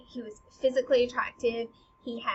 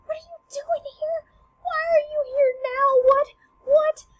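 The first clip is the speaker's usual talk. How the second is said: very high, startled cries; shocked Hamlet; 8 dB louder than usual. Retold or imitated. Hamlet sees his father's ghost, high startled cries. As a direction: gasp it out, seeing a ghost. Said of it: "So he's like".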